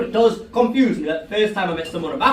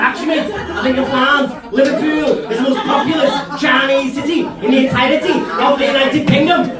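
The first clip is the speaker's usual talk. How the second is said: put-on indignant Liverpool accent, higher pitched; offended Scouse accent; 5 semitones higher, 3 dB louder than usual. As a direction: correct him in a put-out Scouse voice. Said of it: "naturally took a little bit of offence".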